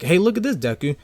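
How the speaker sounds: put-on high, bright girlish excitement; excited Uraraka quote. Uraraka showing Deku her costume, excited and high. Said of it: "Uraraka's like".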